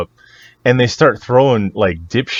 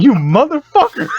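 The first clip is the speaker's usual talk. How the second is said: imitated fury, pitched way up; mock outrage; 4 dB louder than usual. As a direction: curse your lucky friend in comic disbelief. Looking at me like he wants to fucking punch.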